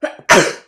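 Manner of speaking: sudden loud sneeze. Real, not acted. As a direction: let out a real sneeze. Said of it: "Sorry for that".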